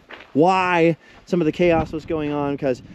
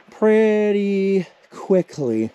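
out of breath; 5 semitones higher, much slower than usual. Real, not acted. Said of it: "I get winded".

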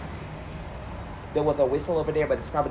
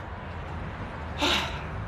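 long sigh; 10 dB quieter than usual. Real, not acted. Frustrated after telling someone off.

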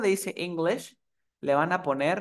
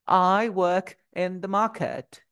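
británico lento, palabra por palabra, sin R; acento británico; much slower than usual. Shown as a demonstration. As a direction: modela la frase en británico con vocales largas. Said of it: "Lo voy a pronunciar en británico". (inglés británico pausado, R mudas)

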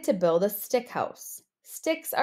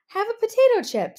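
high, loud, fast pig voice; first pig; 11 semitones higher, 5 dB louder, faster than usual. Acted. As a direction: chirp the offer quickly up high. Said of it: "said the first pig".

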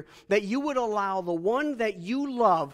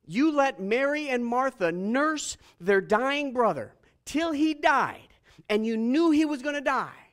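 indignant accusation; 6 semitones higher, 6 dB louder than usual. Acted. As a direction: drag out each charge in raised protest. Acted confrontation of Jesus.

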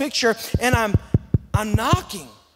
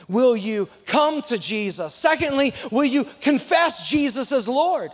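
ordinary delivery

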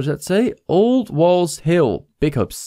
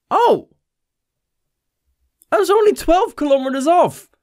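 delighted surprise; 14 semitones higher than usual. Real, not acted. Pleasantly surprised by close guess.

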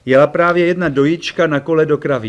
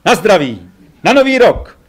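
high, loud New Year toast; called toast; 5 semitones higher, 5 dB louder than usual. A narrator's reading. Ferry toasting the New Year with friends, calling out. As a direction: call the toast, clinking glasses. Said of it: "volal Ferry".

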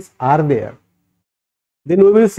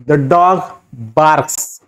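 slow model answer; much slower than usual. Shown as a demonstration. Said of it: "So here".

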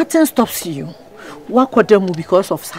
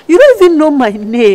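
louder, higher, exasperated snap; angry retort; 5 semitones higher, 8 dB louder than usual. Acted. Married woman snapping at the man who keeps following her. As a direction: throw it back at him in disbelief.

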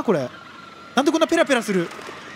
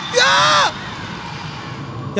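long scream; much slower than usual. Real, not acted. Scared by cold water in game.

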